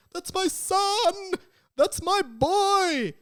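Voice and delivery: high, loud anguished wail, slow; Amos Diggory. Retold or imitated. Grieving father shouting over his son, high wail. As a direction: cry out for the dead son. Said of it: "he's like".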